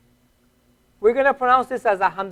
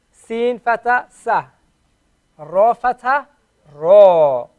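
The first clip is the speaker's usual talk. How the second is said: ordinary talk, nothing marked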